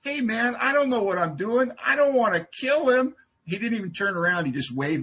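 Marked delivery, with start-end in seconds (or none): 0.0-3.2 calling after him, higher; mock panicked shout at his back; retold yell. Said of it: "I yelled at his bald head".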